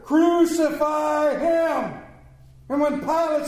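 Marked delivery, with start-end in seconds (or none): 0.0-2.0 loud, slow, drawn-out shout; shouted louder. Acted. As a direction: bellow it like an angry mob. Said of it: "they shouted louder".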